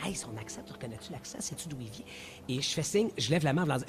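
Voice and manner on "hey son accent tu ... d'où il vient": much softer, a near-whisper kept off the mic; hushed off-air aside. Retold or imitated. Host hushes a question to colleagues off the air, very softly. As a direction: murmur so the caller can't hear. Off-air signs, hiding from caller.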